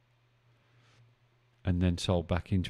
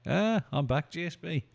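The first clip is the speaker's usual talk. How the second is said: cheery return; 9 semitones higher than usual. Real, not acted. Returns to stream after dropout.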